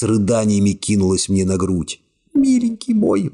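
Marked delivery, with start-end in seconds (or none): 2.3-3.3 high, sobbing-tinged murmur of love; high tearful young woman. A narrator's reading. Secretary tearfully clinging to the hero, high and weepy. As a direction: voice her high, choking back tears. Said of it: "бормотала она, глотая слёзы".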